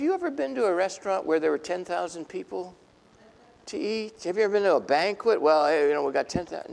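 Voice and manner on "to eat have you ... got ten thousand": usual speaking voice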